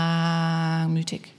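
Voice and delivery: "langmütig" with a very long, low, held first syllable; drawn-out word. Shown as a demonstration. Preacher illustrates patient love, stretching "langmütig" slowly. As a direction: stretch the "lang" in "langmütig" on one low note.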